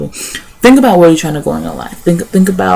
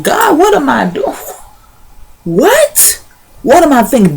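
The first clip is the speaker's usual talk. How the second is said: alarmed realization; 10 semitones higher than usual. Retold or imitated. Woman catching herself mid-sin, shocked and high-pitched. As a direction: pitch leaps way up in shocked disbelief.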